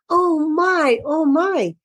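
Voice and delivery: a hammed-up little cry of oh my; mock kitten crying. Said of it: "and they began to cry".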